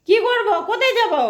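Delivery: high, loud pet voice; high whiny dog voice; voicing the dog. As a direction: speak for the dog, whining.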